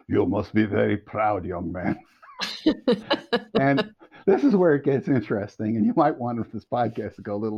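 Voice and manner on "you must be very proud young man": lightly voiced elder statesman, lower pitch; a hint of a solemn dignitary; Henry Kissinger. Statesman congratulates the songwriter at the gala, lightly imitated. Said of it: "And he says to me".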